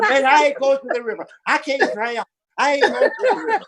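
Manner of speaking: high, comic wail of protest; mock panicked yelling. Friend refusing the river, hammed-up panic. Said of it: "he started yelling".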